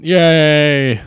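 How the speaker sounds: high, loud happy cheer; cheering yell. Retold or imitated. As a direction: shout it out in sudden triumph. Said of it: "she yells out".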